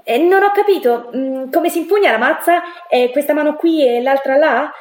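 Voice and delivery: high, feigned cluelessness; playing dumb. A narrator's reading. Girl feigns ignorance to get Enrique closer, playing dumb. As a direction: act the helpless beginner, drawing it out. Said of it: "io facevo l'ignorante".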